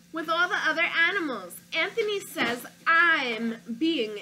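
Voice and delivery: put-on storybook read-aloud, childlike narrator; reading to children. Teacher reading a picture book to her class, storytelling voice. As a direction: read it like a stubborn little boy.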